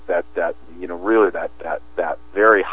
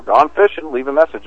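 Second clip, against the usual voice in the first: answering machine line; 7 semitones higher, 3 dB louder than usual. Retold or imitated. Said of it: "was the guy who says".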